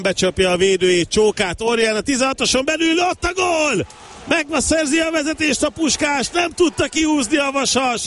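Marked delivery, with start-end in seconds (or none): 1.6-8.0 rising, fast, shouting the goal; excited goal call. Real, not acted. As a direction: erupt as the ball goes in.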